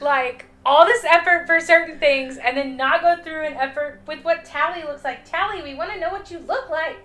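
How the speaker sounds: exasperated. Real, not acted. Complaining about Tali.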